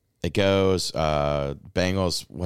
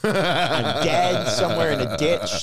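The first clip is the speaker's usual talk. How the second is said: high, loud, drawn-out comic incredulity; stunned disbelief; 11 semitones higher, 6 dB louder, slower than usual. Retold or imitated. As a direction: exclaim it half-laughing, convinced this can't be real. Acting out his stunned disbelief.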